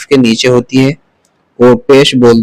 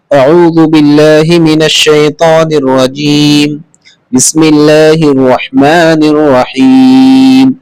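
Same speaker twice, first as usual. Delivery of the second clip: drawn-out, measured recitation of the opening formulas; slow Quranic recitation; 2 semitones higher, much slower than usual. Shown as a demonstration. Teacher recites the opening formulas before the lesson, slow and drawn out. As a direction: recite slowly, stretching each word like at the start of a lesson. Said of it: "جیسے".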